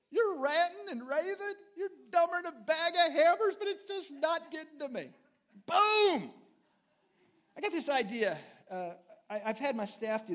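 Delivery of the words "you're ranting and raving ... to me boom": higher, louder, mocking the ranter; preacher taunting an imagined ranter, gleeful. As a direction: crow it out triumphantly, ending on a loud Boom. Mock taunting an angry person.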